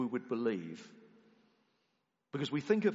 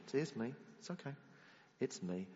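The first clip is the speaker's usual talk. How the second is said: soft reassurance; 7 dB quieter than usual. Retold or imitated. Jesus reassuring the listener.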